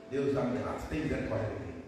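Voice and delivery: very low-pitched plea for mercy; humble prayer. Acted. Believer begging God for mercy, humble low prayer. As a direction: pray it low, sinking at the end. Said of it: "Você vai orar".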